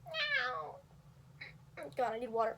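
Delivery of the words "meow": high cartoon cat meow; cartoony meow. Shown as a demonstration. Straining her voice for a realistic meow, cartoonish. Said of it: "It's sounding a little more cartoony".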